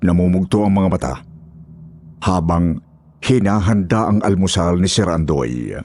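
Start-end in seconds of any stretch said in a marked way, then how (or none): none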